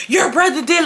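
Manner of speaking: high, mild mock yell; retold yelling. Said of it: "yelling in his face".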